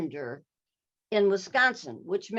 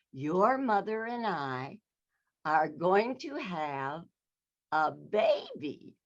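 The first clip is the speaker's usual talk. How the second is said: slow, drawn-out reveal with long pauses; slow announcement; much slower than usual. Retold or imitated. Dad announcing a baby to his teenage daughter, slow and drawn out. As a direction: spin out each word for suspense.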